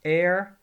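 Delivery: "Air" hit loud and a little high; stressed keyword. Shown as a demonstration. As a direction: call out "Air" as the key word to remember. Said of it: "answering negatively with ní".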